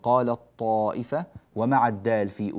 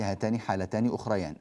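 normal talking voice

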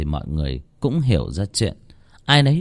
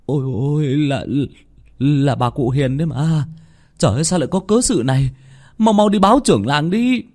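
shocked villager; 6 semitones higher than usual. Acted. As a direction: gasp it behind a hand, then press them to go. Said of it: "Một người trong đám che miệng nói". (dân làng hốt hoảng, giục đi báo trưởng làng)

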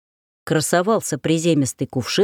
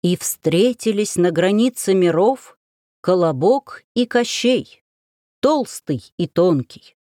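медленный пафосный голос трейлера; торжественно, как анонс блокбастера; slower than usual. Acted. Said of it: "пафосным баритоном, как из трейлера". (пафосный закадровый голос блокбастера)

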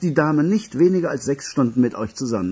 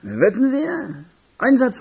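much higher, amused disbelief; incredulous; 10 semitones higher, slower than usual. Acted. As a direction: throw the bet back at him. Echoing the bet in disbelief.

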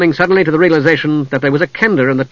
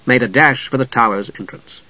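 ordinary delivery, nothing marked

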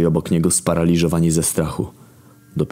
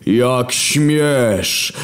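God's raised outraged cry; furious shout; 8 semitones higher, 3 dB louder than usual. Acted. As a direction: roar at the insolence. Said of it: "wrzasnął znowu stwórca".

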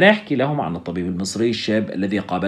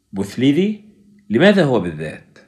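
jolted cry, louder at the end; startled awake; 3 semitones higher, 4 dB louder than usual. Acted. Doctor waking from a nightmare, startled cry. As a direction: wake in fright and blurt the name. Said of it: "هب من النوم مذعورًا".

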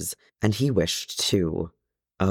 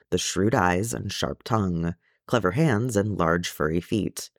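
plain reading voice

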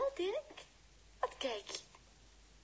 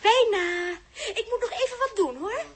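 ordinary talk, nothing marked

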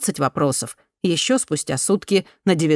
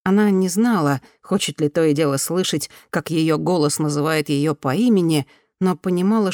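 ordinary delivery

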